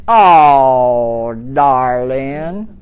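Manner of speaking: low, long Southern darling; drawn-out vowels, soothing Southern style; mock Southern endearment; Southern drawl. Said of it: "It's the vowel".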